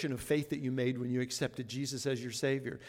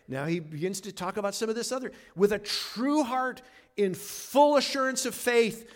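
ordinary delivery

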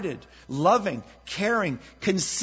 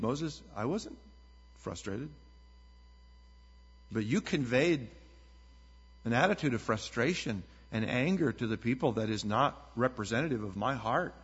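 gentle rebuke; 5 semitones lower, 5 dB quieter than usual. Acted. God gently correcting Moses.

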